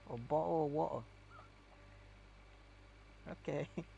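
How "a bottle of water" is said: glottal cockney English "bottle of water", dropped t's; a light cockney English "water" with no r. Retold or imitated. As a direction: say "A bottle of water." as a Londoner, t swallowed. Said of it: "the other type of English".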